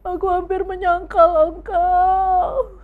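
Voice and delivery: high, loud, slow crying confession; acted weeping prayer; tearful repentance. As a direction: sob it out as a repentant boy.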